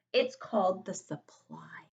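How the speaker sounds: hushed whisper; breath-only, confiding narration; whispered reveal. A narrator's reading. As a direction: whisper it like a secret. Reveals the vocab word in whisper.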